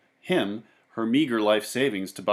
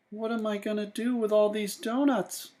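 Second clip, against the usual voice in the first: put-on higher, softer boy voice; Sam asking himself; 9 semitones higher, 4 dB quieter than usual. A narrator's reading. Sam alone in the city, softly higher. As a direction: wonder aloud as Sam, a little softer. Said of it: "he asked himself".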